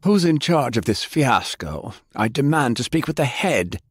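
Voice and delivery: pompous bellow; blustering demand. Acted. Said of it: "bellowing bull of a man, sputtering and swearing".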